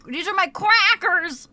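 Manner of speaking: playful showing off. Pretend. Streamer presenting her crackers to chat, playful. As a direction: show them off proudly.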